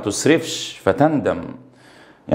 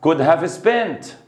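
slowly dictated answer, each word spaced; slow answer reading; 3 semitones higher, much slower than usual. Shown as a demonstration. Teacher gives the answer to students, slow and clear. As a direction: read the correct answer out slowly for the class. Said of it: "يبقى الإجابة".

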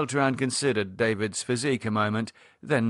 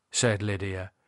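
usual speaking voice

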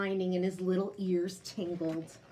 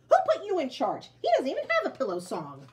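high, loud huffy retort; indignant; 6 semitones higher, 7 dB louder, faster than usual. Acted. Jack-in-the-box arguing with Bird, loud. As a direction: challenge him angrily.

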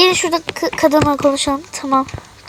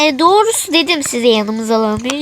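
ordinary delivery

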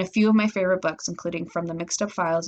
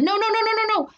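high, panicked string of cries; horrified yelling; 3 dB louder than usual. A narrator's reading. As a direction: wail in rising disbelief at disaster. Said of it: "What's all the yelling?".

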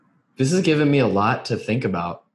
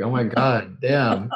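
usual speaking voice

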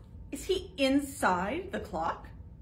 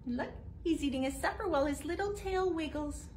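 ordinary delivery

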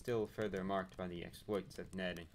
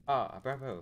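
calling out; 4 semitones higher, 7 dB louder than usual. Acted. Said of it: "I called out to him in greeting".

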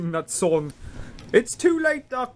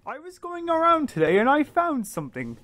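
lightly voiced Oliver, higher and fast; bouncy eager kid rattling off news; 7 semitones higher, 3 dB quieter, much faster than usual. Oliver tells Duck about his find, higher and fast.

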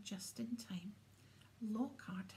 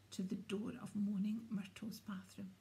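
ordinary delivery

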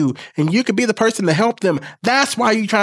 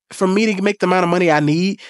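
ordinary talk

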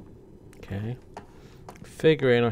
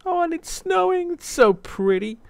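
much higher, thrilled and charmed; delighted squeal; 4 dB louder than usual. Real, not acted. Seeing snow in the game, a high delighted gush. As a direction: gush over the pretty snow.

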